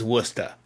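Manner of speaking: "Worcester" as WUSS-tuh, no R; local Massachusetts pronunciation. Shown as a demonstration. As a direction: local two-syllable "Worcester", ending "tuh". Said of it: "that's how we say things".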